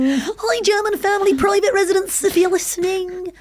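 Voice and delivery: high, drawn-out singsong call; teasing hello, pitch held high; mock-friendly greeting. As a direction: greet them with playful false cheer. Joins pretend greeting to German family.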